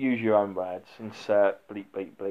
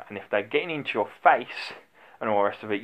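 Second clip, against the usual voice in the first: ordinary talk, nothing marked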